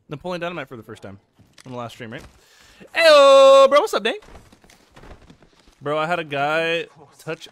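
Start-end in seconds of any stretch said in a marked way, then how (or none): 2.9-4.1 high, very loud drawn-out hail; big loud call-out; shouted greeting. Real, not acted. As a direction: holler a hello to a friend. Calling out greeting to Dane.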